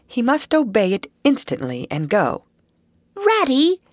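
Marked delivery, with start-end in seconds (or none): 3.1-3.8 high, loud excited call of Ratty; joyful call. Acted. Mole calling ahead to Ratty, high and joyful. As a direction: shout Ratty's name in delight. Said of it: "full of joyful excitement".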